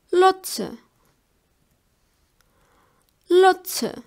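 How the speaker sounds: German "Lotse" with a clear ts in the middle; ts pronunciation demo. Shown as a demonstration. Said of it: "They are pronounced like ts".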